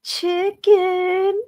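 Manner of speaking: high, sing-song proud "Chicken."; confidently wrong contestant. Retold or imitated. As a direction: chirp it out, brimming with confidence. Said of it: "she does this whole dance. She goes".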